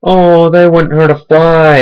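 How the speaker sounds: high, slow mocking owner voice; mocking dog owner. Mocking what every dog owner says, high slow voice. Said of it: "every dog owner is like".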